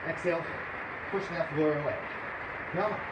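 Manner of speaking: ordinary talk, nothing marked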